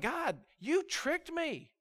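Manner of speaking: high, drawn-out accusation; slow, high acted exasperation; frustrated. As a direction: complain to God as a frustrated prophet. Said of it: "He's frustrated".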